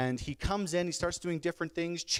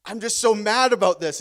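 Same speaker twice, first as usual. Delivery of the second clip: loud, raised-pitch acted outburst; mock domestic rant at full volume; angry complaint; 5 semitones higher, 10 dB louder than usual. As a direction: blurt it out like a fuming husband. Acted quarrel over dishes.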